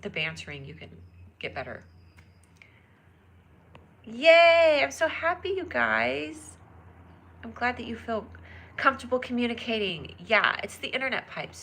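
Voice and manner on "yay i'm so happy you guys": high, loud yay then bright thanks; cheerful yay. Real, not acted. Streamer glad a fan feels comfortable, cheering high. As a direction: cheer it out to chat.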